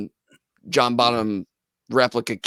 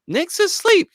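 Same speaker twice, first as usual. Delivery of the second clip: much higher mock girlfriend voice; imitating girlfriend; 3 dB louder than usual. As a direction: squeak it like a startled girlfriend. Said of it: "She's like".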